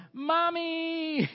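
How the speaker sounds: high, very loud, stretched-out yell. Retold or imitated. Son spotting his mother across the park, yelling. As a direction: holler it long across a crowded park. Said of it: "and he yells".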